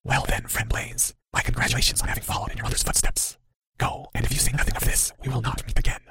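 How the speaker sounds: very soft, sinister whisper; whispery. A narrator's reading. Cloaked figure dismisses the boy, menacing whisper. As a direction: whisper a veiled farewell threat. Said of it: "Again, the whispery chuckle".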